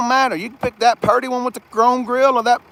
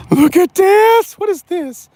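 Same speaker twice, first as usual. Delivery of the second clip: excited; 12 semitones higher, 8 dB louder, slower than usual. Real, not acted. Showing off the truck's floor.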